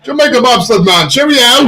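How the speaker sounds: high, hammed-up Jamaican accent; mock Jamaican accent. Host ribbing the co-host over accents, mock Jamaican. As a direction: pitch up a comic Jamaican accent. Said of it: "You can't do Jamaican?".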